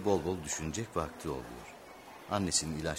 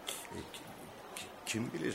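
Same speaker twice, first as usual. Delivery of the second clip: soft, halting start with broken syllables; hesitant stammer; 4 semitones higher, 9 dB quieter than usual. Acted. Father answers a question about grandfather, stammering. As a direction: stumble softly over the first word.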